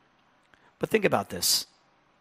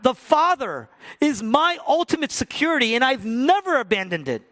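much higher, louder, full-force preaching; impassioned preaching; 14 semitones higher, 9 dB louder than usual. Real, not acted. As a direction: preach at full fervour to the climax. Peak of sermon, impassioned.

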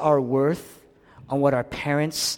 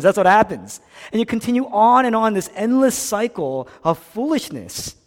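usual speaking voice

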